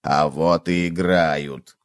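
low, slow, stubborn bug; low plodding contradiction; insistent cockroach. A narrator's reading. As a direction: insist calmly, drawn out and low. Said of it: "настаивал таракан".

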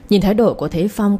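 ordinary delivery

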